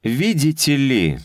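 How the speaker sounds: ordinary delivery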